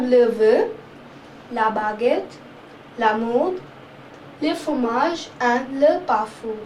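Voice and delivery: le vin, la baguette, la mode said the French way; the words spoken with French nasal vowels; French words review. Shown as a demonstration. Teacher reviewing the French vocabulary list. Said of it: "So we have".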